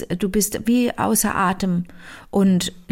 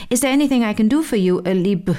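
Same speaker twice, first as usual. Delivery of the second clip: mock Irish reading; 2 semitones higher, faster than usual. Shown as a demonstration. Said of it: "Ich hab's so gelesen".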